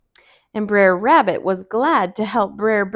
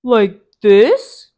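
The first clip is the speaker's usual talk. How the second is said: Brer Bear; 12 dB louder than usual. Acted. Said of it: "asked Brer Bear".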